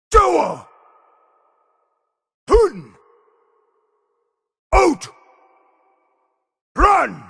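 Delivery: high, explosive single-word shouts; full shout. Acted. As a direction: hurl each word out, pitch falling away. Isolated battle shouts in game.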